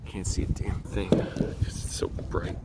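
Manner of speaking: soft unvoiced whisper, slow; whispered grumble at the glare; whispered aside. Real, not acted. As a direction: mutter it under your breath to yourself. Muttering aside while filming outside.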